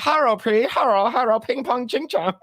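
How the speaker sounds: high hammed-up fake accent greeting; mock Chinese act. Cartman in disguise greeting, high hammed-up mock voice. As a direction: ham up a mocking fake voice. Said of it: "And he's like".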